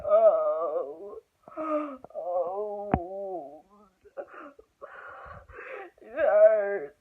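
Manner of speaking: loud, crying mock moans; re-enacted labor pain. Showing her face in triage, hammed-up pained moaning. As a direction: wail through a contraction, imitated. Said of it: "That is literally my face".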